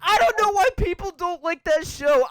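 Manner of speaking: loud and high enthusiasm; excited. Real, not acted. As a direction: gush about a favourite show.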